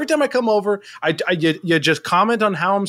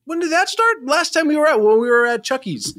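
high-pitched agitated recounting; worked up, pitch climbing in indignation; voice rising, intense; 7 semitones higher than usual. Acted. Party host defending himself to a friend, upset and high-pitched. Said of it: "So your voice goes up. Your, your tone is intense".

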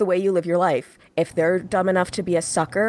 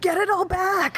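panicked; 13 semitones higher than usual. Retold or imitated. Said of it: "they're like".

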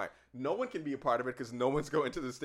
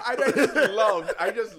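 laughing it out, slowed and louder; 9 semitones higher, 9 dB louder, slower than usual. Real, not acted. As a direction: giggle through the words, drawn out. Laughing while recounting the trailer.

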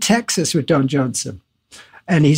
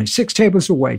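normal talking voice

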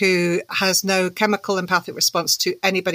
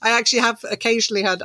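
normal talking voice

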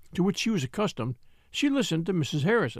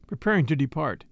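ordinary delivery, nothing marked

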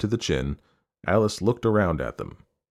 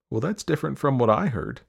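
higher, light mother's voice; challenging mother; 7 semitones higher than usual. A narrator's reading. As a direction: gently contradict your daughter. Mother challenges her daughter.

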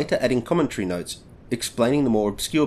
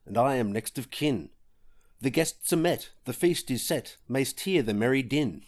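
dramatic poem recitation; 4 dB quieter than usual. Acted. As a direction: recite it like an old ballad on stage. Recites the poem's opening lines.

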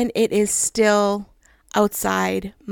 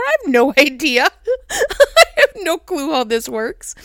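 laughing; 4 semitones higher, 4 dB louder than usual. Real, not acted. Laughing at own couch ignorance.

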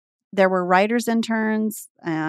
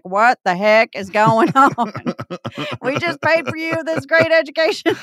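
exasperated dad; 3 semitones higher, 3 dB louder than usual. Retold or imitated. Dad scolding grad who moved back home, mock exasperation. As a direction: imitate an indignant parent. Dad's exasperated reaction, acted in story.

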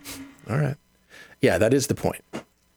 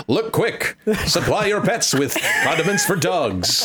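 high, hyped ad announcer read, laughing; 7 semitones higher than usual. Pretend. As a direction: pitch it up like a cheesy TV ad announcer. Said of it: "I'm gonna do the announcer voice for it".

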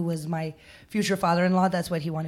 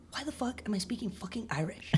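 exasperated whisper; frustrated whispered aside; 3 semitones higher, 11 dB quieter, faster than usual. Retold or imitated. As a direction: hiss it under your breath in disbelief. Venting her inner frustration, whispered.

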